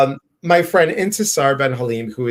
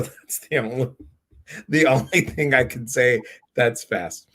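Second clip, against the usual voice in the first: ordinary talk, nothing marked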